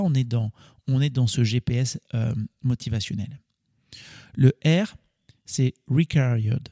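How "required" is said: "required" drawn out with a French accent; French-accented English. Real, not acted. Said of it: "excuser mon, mon accent anglais". (anglais avec l'accent français)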